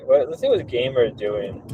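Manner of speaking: ordinary talk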